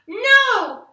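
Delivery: high, loud shrieked no; child refusing a haircut, shrieking. A narrator's reading. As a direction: shriek it out in a tantrum. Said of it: "she shrieked and stamped her foot".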